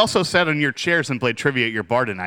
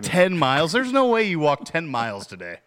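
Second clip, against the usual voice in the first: higher-pitched disbelieving challenge; incredulous; 6 semitones higher than usual. Real, not acted. Challenging a friend's claim of walking ten miles, incredulous. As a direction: question it in open disbelief.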